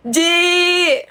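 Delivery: high, long-held wailing call; imitated yell. Friend calls to the speaker from the gate, imitated yell. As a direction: call out the name like a distressed friend. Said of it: "dari gerbang dia udah teriak".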